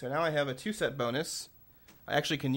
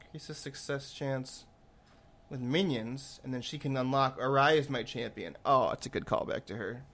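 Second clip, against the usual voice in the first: usual speaking voice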